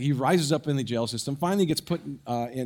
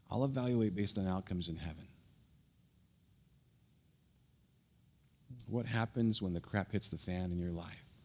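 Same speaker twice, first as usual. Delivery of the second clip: quiet earnest exhortation; 5 semitones lower, 7 dB quieter than usual. Acted. Pastor quietly challenging the congregation, soft and earnest. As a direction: lean in and speak it gently.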